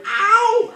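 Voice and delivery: very high, loud "ow"; shouted yelp of pain. A narrator's reading. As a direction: yell out as if sharply hurt. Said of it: "then shouted".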